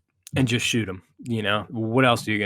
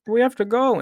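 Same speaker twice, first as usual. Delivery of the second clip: high-pitched urgent plea; mock urgent quote; 12 semitones higher than usual. Friend retelling movie line in a raised voice, urgent. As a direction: squeak it out like a scared kid.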